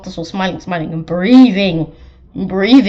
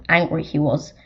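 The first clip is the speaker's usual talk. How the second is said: ordinary delivery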